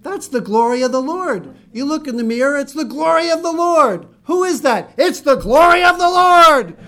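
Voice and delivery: hammed-up glory of the Lord cheer; excited encouragement. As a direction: build up as if cheering someone on. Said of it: "somebody told me".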